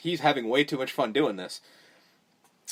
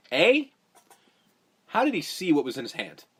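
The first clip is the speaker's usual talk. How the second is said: ordinary talk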